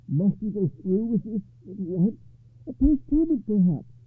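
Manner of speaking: a king grasping for a way out; nervous king. A narrator's reading. Arthur pleads with his nephew.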